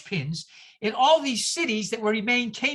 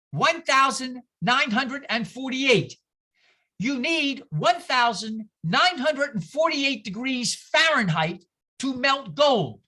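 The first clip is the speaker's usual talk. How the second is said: slow emphasis. Shown as a demonstration. Stressing a big number slowly.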